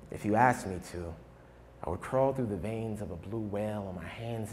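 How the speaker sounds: drops from emphatic to low, soft verse; quiet, measured spoken-word close with long pauses; poem recital, winding down. Acted. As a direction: let the love poem settle into a hush.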